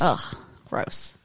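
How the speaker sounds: whispered, low ugh of disgust. Real, not acted. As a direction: recoil from the taste. Disgust at Miller High Life.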